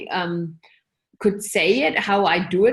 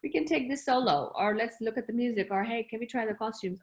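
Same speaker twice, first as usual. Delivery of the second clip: much softer, murmured to herself; quiet aside; 8 dB quieter than usual. Retold or imitated. Telling herself the scene can wait, quietly and softly.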